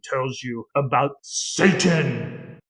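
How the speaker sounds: slow, ominous announcer drawl; lightly voiced spooky museum narrator, stretched out; mock deep narrator voice. Retelling the museum show, slow mock-ominous voice. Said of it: "comes on with a deep voice".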